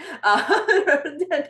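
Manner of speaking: high, open laugh. Real, not acted. Laughs after her point.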